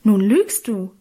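loud, indignant accusation. A narrator's reading. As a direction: call out in indignation. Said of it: "rief ich entrüstet".

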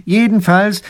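resolute. A narrator's reading. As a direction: cut the debate short firmly. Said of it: "meint entschlossen der Großherzog".

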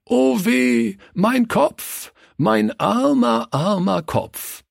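high, slow, theatrical wailing; moaning and lamenting. A narrator's reading. Pastor pretending he was beaten, moaning and lamenting. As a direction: play the hurt victim, dragging out each moan. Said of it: "stöhnend und klagend".

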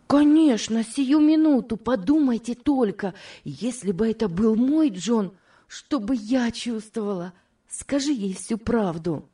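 put-on high-pitched, excited young friend; eager urging. Ruth urges friends to tell the wife, excitedly. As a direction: burst out high, then ease into pleading.

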